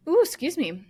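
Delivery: hiccuping mid-sentence; hiccups. Real, not acted. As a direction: talk through a hiccup. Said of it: "I'm hiccuping".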